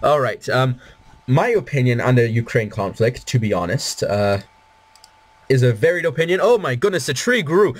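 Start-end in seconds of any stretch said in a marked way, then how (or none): 6.4-7.7 higher and delighted, the tree grew; thrilled by the growing tree; excited. Real, not acted. Tree grows in his game.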